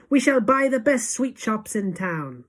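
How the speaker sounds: high, loud eager pledge; crowd cry. A narrator's reading. Witches promising their leader, loud eager cry. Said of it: "they cried".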